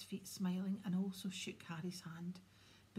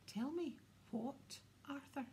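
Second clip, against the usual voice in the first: pointed demand; 6 semitones higher, 5 dB quieter than usual. A narrator's reading. Wife turning on her husband, pointed demand. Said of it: "her eyes snapped back to her husband".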